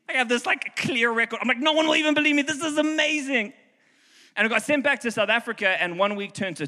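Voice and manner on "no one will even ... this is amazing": high, giddy and thrilled; giddy excitement. Retold or imitated. Pastor retelling his glee at the arrest, high and giddy. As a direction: squeal it out like it's the best story ever.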